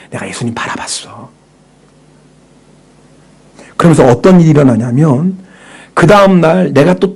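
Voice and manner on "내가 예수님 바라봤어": whispered inner thought, 아 breathed softly. Pretend. Preacher voicing a believer's diary thought, whispered. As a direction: whisper it like a private realization.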